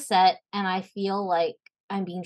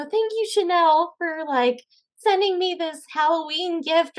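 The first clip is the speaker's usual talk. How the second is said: sugary scene line; 9 semitones higher than usual. Acted. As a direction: gush like the girls in the scene. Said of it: "you know".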